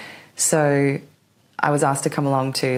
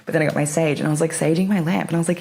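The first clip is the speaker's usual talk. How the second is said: normal talking voice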